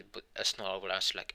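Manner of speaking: ordinary delivery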